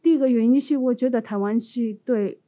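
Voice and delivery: ordinary talk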